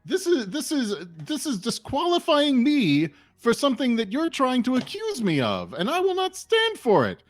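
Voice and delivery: high-pitched, offended protest; indignant accused contestant. Acted. Contestant denies needing trickery, high indignant voice.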